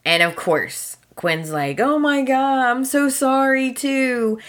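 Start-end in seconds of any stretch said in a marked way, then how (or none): 1.8-4.4 mock crying. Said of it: "And she starts crying".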